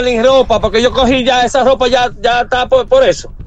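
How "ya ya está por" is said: usual speaking voice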